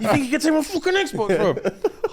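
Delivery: high, fast mock outrage; imagined tantrum. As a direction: rant like a kid kicking off. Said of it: "I bet you kicked off as well".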